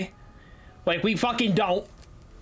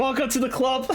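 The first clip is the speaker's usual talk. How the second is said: high, laughing shout; cracking up while welcoming; laughing welcome; 6 semitones higher than usual. Real, not acted. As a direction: call it out through real laughter. Laughing welcome to fellow masshole.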